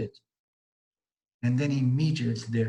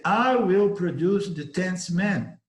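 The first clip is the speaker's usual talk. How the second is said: louder, higher, slow and commanding; powerful certainty; 6 semitones higher, 4 dB louder, slower than usual. Acted. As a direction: promise it with total certainty. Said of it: "said that with such power, certainty".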